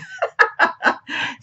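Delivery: breathy, high-pitched little laugh. Real, not acted. Amused at forgetting her palettes, she laughs airily. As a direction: let out a short airy laugh.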